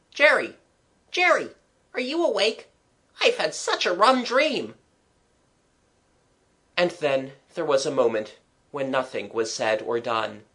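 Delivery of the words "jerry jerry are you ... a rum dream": put-on high boyish voice, drowsy and puzzled; a young boy calling his brother in the dark; sleepy boy waking. Younger brother waking his brother in the dark, high boyish voice. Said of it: "Another voice said".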